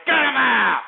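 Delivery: loud, high bellowed order; explosive, exasperated shout; angry shout. Real, not acted. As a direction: yell to have the caller cut off. Host throws caller off air.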